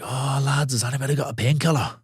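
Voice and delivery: a clumsy mock Scottish lad asking for pills; terrible Scottish accent. Retelling a backstage wrestler to his co-host in a bad Scottish accent. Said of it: "That was a terrible Scottish accent".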